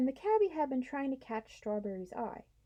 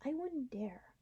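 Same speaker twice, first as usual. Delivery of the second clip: timid whisper; 4 semitones higher, 6 dB quieter, slower than usual. Acted. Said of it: "Do you think we can?".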